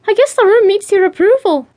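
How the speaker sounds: high, loud, enthusiastic character voice. A narrator's reading. Bea shows Jo her new room, enthusiastic. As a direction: gush with lifted pitch and extra volume. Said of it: "Bea said enthusiastically".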